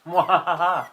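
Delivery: mock mwahaha cackle; evil villain laugh. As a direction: cackle like a cartoon baddie.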